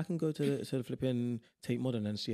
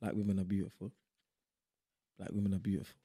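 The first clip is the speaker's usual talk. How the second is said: lower-pitched spoken-word refrain; deep poem recital; 4 semitones lower than usual. Acted. Reciting own poem for a friend, deep and deliberate. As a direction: repeat the line low, as a solemn poem. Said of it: "it's getting deeper and deeper".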